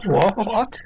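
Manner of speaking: high, startled what; mock surprise. Robot Jim acting innocent to friend computer, high mock surprise. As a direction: fake shock to cover a lie.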